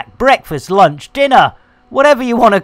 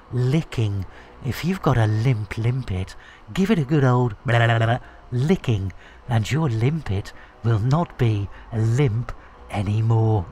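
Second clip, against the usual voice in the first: soft, low, hushed mock-ASMR murmur; 9 semitones lower, 11 dB quieter than usual. Streamer doing a joke ASMR bit for chat, hushed and low. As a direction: purr it like a cheeky ASMR video. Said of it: "for the A S M R".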